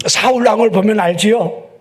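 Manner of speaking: normal talking voice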